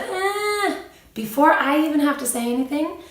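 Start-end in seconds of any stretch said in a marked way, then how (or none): 0.0-0.8 high little hurt whine; a child's small pained whimper; imitated whimper. Mother imitating a hurt toddler's whine, high. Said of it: "there's a".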